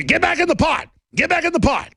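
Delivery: high, insistent mock command; flustered captor. Captors losing control of the patriot, mock flustered.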